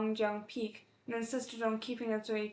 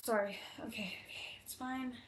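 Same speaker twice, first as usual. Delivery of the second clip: real whisper, collecting herself; barely audible, calming herself down; tearful whisper; 7 dB quieter than usual. Real, not acted. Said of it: "Can I not cry".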